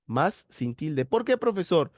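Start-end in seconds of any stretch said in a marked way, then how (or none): 1.1-1.9 student asking. Acted. Teacher voices a student's question.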